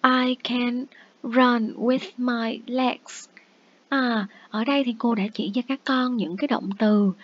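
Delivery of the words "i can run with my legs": put-on very slow, each word spaced out for children; slow, clear teacher demo; slow model sentence. Shown as a demonstration. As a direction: model it slowly for young learners. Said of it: "sẽ nói là".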